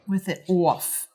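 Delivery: slow teasing Long Island "with it off"; mocking Long Island accent. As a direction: drag it out in an exaggerated Long Island accent to poke fun. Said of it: "I was making fun of your accent".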